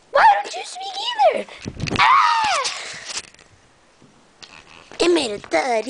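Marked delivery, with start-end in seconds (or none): 0.1-2.7 very high, squealed outburst; hammed-up tantrum shriek; frustrated screech. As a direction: screech at it and end in a scream. Frustrated at silent toy.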